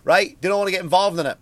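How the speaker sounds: ordinary delivery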